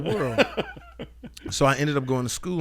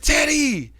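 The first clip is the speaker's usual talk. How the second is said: loud, high call after someone; urgent high-pitched shout to catch someone; 12 semitones higher, 7 dB louder than usual. Retold or imitated. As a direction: holler a name down the hallway. Calling out after Teddy in hallway.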